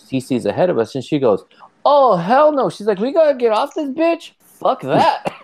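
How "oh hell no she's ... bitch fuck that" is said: high-pitched mock freak-out, a lady bailing off the tram; panicked lady. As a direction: squeal it like a lady refusing to ride on.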